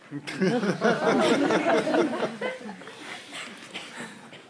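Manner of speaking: put-on light drawn-out childish giggle; long giggle. Child laughs after comparing goat to Christ, giggling. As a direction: giggle at your own joke.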